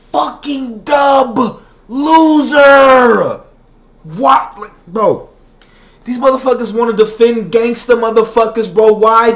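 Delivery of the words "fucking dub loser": contemptuous shout. Real, not acted. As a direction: spit out the insult. Insulting the man.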